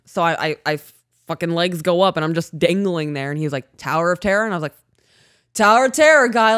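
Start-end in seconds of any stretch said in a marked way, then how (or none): 5.5-6.6 high, loud exclamation; retold emphatic agreement. Climber answering guide before the drop, emphatic agreement. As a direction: throw it back higher and louder.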